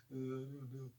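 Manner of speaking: cicho i smutno, jak porzucony chłopak; mock heartbroken mumble. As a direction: mumble it like a dumped friend, a touch sad. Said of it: "He's like".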